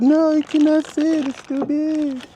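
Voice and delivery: very high falsetto, slow and whiny; mock woman's voice. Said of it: "She said".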